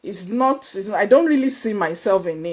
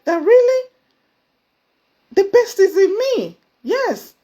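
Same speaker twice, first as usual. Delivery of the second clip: high, louder joyful cry; excited; 11 semitones higher, 5 dB louder than usual. Real, not acted. Recalling her success to listeners, excited high cry. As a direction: burst out with the joy of it. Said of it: "I was so excited".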